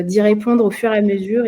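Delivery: ordinary talk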